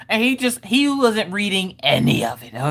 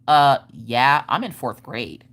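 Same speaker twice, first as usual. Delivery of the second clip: put-on low, offended kid comeback; super sassy; 6 semitones lower, slower than usual. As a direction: answer as if it's obvious. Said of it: "She being super sassy".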